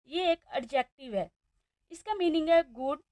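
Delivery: ordinary talk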